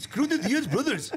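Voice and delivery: put-on high, slow Indian-accented line, laughing.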